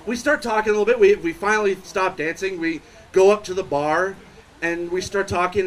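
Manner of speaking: usual speaking voice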